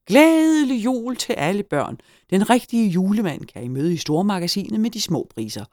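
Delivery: high, loud festive announcer; blaring store announcement. A narrator's reading. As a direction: proclaim it over the store loudspeaker. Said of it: "gjaldede det over højtaleren".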